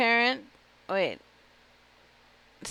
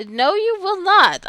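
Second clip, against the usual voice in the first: much higher, louder, slow outrage; indignant refusal; 10 semitones higher, 8 dB louder than usual. Acted. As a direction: put your foot down, affronted.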